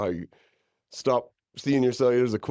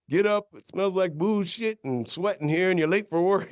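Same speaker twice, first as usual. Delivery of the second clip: high scolding mother; mom voice; 10 semitones higher than usual. Retold or imitated. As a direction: nag like his mom waking him.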